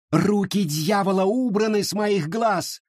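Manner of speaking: high exalted cry. A narrator's reading. Charity cries out before the crowd, high and exalted. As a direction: proclaim it at the top of your voice. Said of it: "во весь голос кричала Черити".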